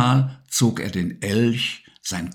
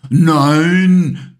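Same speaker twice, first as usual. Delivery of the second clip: roaring lion; 7 semitones higher, 8 dB louder than usual. A narrator's reading. Simba the little lion roaring back at Maya, loud. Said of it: "brüllte Simba".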